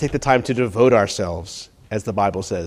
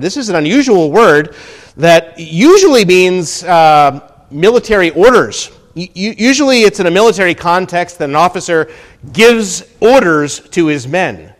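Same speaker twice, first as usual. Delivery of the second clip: loud, raised, emphatic teaching from the pulpit; forceful preaching; 6 semitones higher, 9 dB louder than usual. Acted. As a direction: drive the point home loudly.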